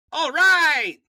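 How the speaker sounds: put-on loud then dropping Latin accent sign-off; a boss closing the call, pleased. Supreme Master thanks the brother in a Latin accent. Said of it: "this is my Latin accent".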